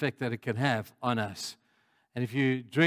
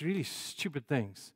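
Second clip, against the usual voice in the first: ordinary talk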